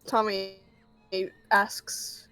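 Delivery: normal talking voice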